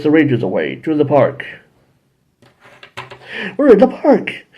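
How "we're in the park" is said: high surprised cry; surprised hero. Retold or imitated. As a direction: exclaim like a startled kid hero. Rangers teleported, voiced.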